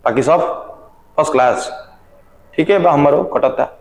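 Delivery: low, easy Bhojpuri small talk; unhurried chat with pauses between phrases; normal casual talking. Shown as a demonstration. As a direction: demonstrate relaxed everyday chat, pitched a little down. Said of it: "normal जैसे आप talk कर रहे हैं".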